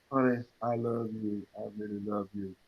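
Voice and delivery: low, soft, limp "I love you"; unconvincing. Retold or imitated. Telling your wife you love her, limp and unconvincing. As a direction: say it like you don't mean it. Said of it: "you don't sound very convincing".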